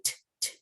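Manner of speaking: t as a lone consonant, no vowel; just release the t; bare t sound. Shown as a demonstration. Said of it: "that sound".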